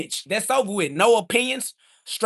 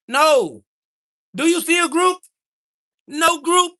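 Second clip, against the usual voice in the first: indignant outburst; 8 semitones higher, 4 dB louder than usual. Real, not acted. Streamer to chat asking if he's a group, indignant high outburst. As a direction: voice jumps high, pushing back at chat.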